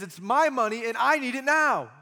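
loud, high, furious demand for money; hammed-up shout of a ruthless creditor; angry demand. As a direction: bark it like a greedy creditor grabbing a throat. Servant demanding repayment, acted.

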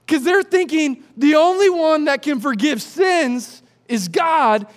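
ordinary talk, nothing marked

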